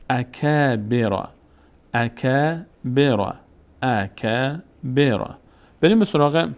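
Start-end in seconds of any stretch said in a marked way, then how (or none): 0.1-5.3 اکبر read very slowly with the long a after ک held; slow, chanted اکبر; slow recitation. Shown as a demonstration.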